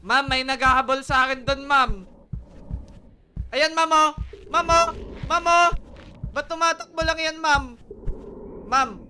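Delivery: loud, high pleading call to teacher; calling out loudly. Real, not acted. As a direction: yell for the teacher's help.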